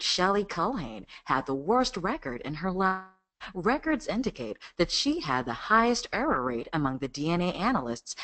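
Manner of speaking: lightly deepen into a mature American documentary read. Shown as a demonstration. Voice actor showing a second take, lightly mature American accent. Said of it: "a more mature voice in American accent".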